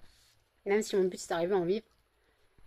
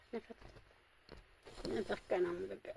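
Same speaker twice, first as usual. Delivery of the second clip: soft coaxing; 12 dB quieter than usual. Real, not acted. Calling the dog for a cuddle.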